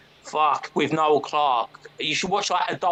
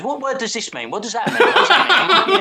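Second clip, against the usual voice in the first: rising higher and louder, ending in a squeaky yelp; mock confused questions; 8 semitones higher, 7 dB louder than usual. Friend John pestering about slang words, building to a high squeal. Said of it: "He goes".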